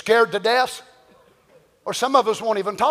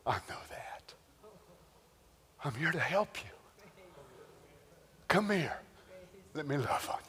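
soft, lower, whispered comfort; tender whispering God; 6 semitones lower, 9 dB quieter than usual. Retold or imitated. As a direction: soothe a frightened child. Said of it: "we would hear a voice".

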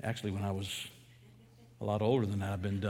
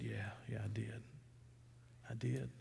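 put-on a caught boy owning up in a hushed whisper; ashamed whisper to a father who caught him; whispered confession; 14 dB quieter than usual. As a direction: whisper it, no voice at all.

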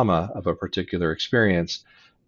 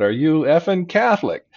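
mock yell; 8 semitones higher, 5 dB louder than usual. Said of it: "he then used the expletive".

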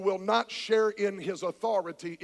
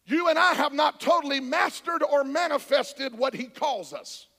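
ordinary talk, nothing marked

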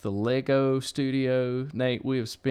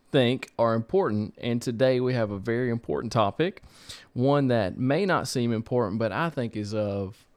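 ordinary delivery

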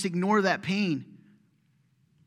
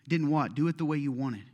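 normal talking voice

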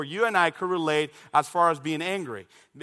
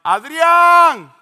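long, high, full-voiced holler; yelling for a neighbor kid; scream across to a friend; 12 semitones higher, 13 dB louder than usual. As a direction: call the name out loud and drawn out. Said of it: "I would scream at Adrian".

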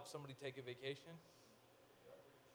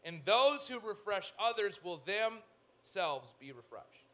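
slow scripture reading; 3 semitones higher, 7 dB louder, slower than usual. Shown as a demonstration. Preacher reads the proverb slowly to his congregation. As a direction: lean into every word of the verse. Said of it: "The generous will prosper".

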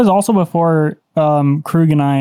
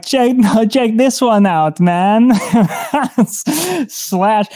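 laughing; 4 semitones higher, much slower than usual. Acted. Bragging to Arturo mid fight.

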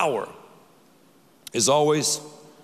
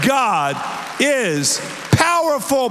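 rising shout; 4 semitones higher, slower than usual. Real, not acted. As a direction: lift the whole room with it. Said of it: "Say it with me again".

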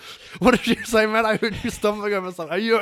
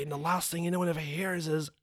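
ordinary delivery

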